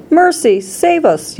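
high, contained cry for help; panicked yell. A narrator's reading. Mother calls for mercy, high panicked yell. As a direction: yell it high, volume held back. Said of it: "yelled Missus Beauregard".

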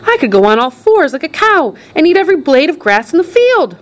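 high, delighted grandpa voice; Grandpa Joe. A narrator's reading. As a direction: run on gleefully with a grin. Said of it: "grinning with delight".

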